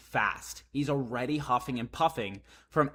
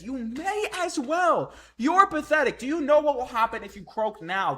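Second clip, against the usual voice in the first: put-on old warrior scolding; 10 semitones higher, 6 dB louder than usual. Reading Gaban scolding Luffy.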